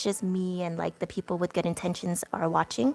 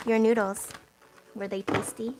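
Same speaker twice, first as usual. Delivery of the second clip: ordinary delivery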